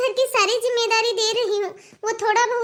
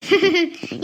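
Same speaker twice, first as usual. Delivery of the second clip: short, low chuckle; low acted laugh of approval; pleased chuckle; 4 semitones lower than usual. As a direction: chuckle at the good news. Mother-in-law hears good news.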